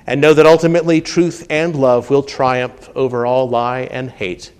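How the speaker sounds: ordinary talk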